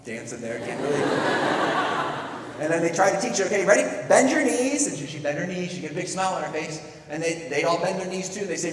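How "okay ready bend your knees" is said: raised, excited sibling voice; eager coaching. Retold or imitated. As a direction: coach a toddler through it, retold. Siblings teaching toddler to jump.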